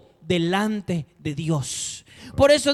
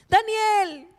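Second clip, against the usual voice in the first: high anguished cry of the name; the king calling his friend in the den, anguished; 11 semitones higher than usual. Acted. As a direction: call the name out, grieving. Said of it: "voz afligida".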